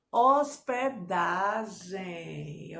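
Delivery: very slow "Hospedagem", each syllable stretched; syllable-by-syllable dictation. Shown as a demonstration. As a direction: draw out "Hospedagem" in separate syllables. Teacher divides word into syllables.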